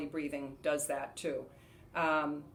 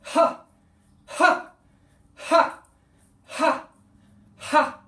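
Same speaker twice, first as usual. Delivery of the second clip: loud, high, explosive "ha" on each breath; forceful ha exhales; 10 semitones higher, 12 dB louder, much slower than usual. Shown as a demonstration. Showing students Hara breathing, forceful ha shouts. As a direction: punch out separate ha breaths, a pause after each. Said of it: "You're basically doing Ha's".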